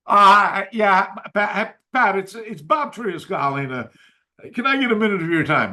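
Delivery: Bob Trias on phone. Retold or imitated. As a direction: call like a grand master on the line. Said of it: "this voice in there that goes like this".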